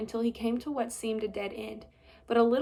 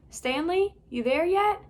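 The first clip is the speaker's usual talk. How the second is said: calling; 7 semitones higher, 6 dB louder than usual. Acted. Chief calling through hole.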